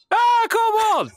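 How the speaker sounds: high, loud drawn-out shriek of alarm; startled scream. Acted. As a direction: scream as if a monster just popped up. Acted fright at sudden kobold.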